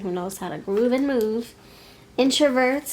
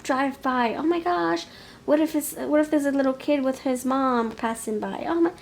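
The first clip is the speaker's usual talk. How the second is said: high, fretful oh-my-gosh voice; acting out a nervous partner, pitched up and anxious; panicky worry; 4 semitones higher than usual. Nervous lover fretting about being seen, high and panicky.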